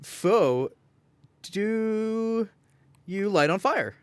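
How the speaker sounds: ordinary delivery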